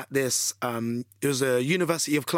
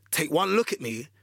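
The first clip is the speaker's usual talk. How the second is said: plain reading voice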